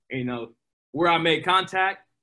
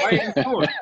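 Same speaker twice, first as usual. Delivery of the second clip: laughing quote; 3 semitones higher than usual. Retold or imitated. Retold thought, laughing at himself.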